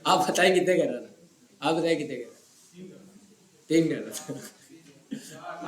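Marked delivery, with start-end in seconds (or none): 1.6-5.7 mock student voice. Mock answering in another voice.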